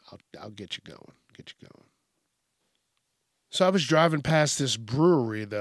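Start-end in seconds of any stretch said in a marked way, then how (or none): 0.1-1.9 whisper. Real, not acted. Fades out to unvoiced.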